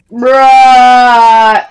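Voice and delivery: one word held out loudly, level pitch; long loud bruh. Retold or imitated. Kid replying to the pizza guy, long loud bruh. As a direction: drag it out as a loud mock comeback. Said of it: "then he's like".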